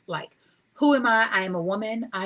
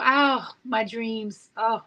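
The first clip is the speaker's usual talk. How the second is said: usual speaking voice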